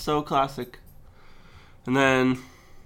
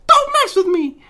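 put-on shrieking, very high kid yelling through laughter; kid's screaming meme; 9 dB louder than usual. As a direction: scream it like a furious little kid. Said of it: "but a kid goes".

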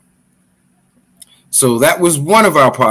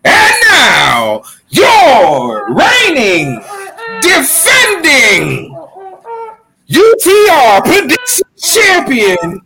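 ring announcer intro; 11 semitones higher than usual. Acted. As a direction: belt out a wrestling ring intro. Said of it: "I'll just do it without the music".

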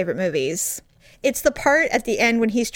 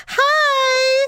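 panicked hi. Retold or imitated. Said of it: "it wasn't like I went, "Oh, hello." I'm like".

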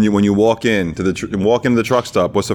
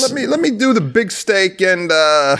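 higher-pitched, dithering order; mock indecisive customer; 7 semitones higher, slower than usual. Customer ordering the big steak, mock dithering. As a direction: hover over the choice, pitched up. Co-host mimics the hated order.

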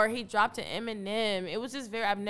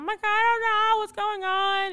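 mock panic; 10 semitones higher, 7 dB louder than usual. Retelling the bleeding after the punch.

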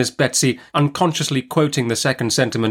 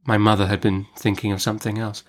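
ordinary delivery

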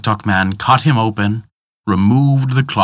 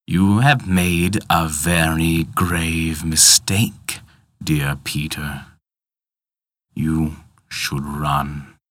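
menacing villain; much slower than usual. Acted. Tick-tock man threatens Peter.

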